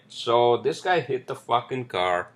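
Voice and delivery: usual speaking voice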